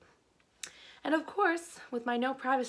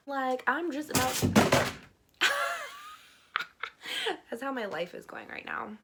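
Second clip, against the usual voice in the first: ordinary talk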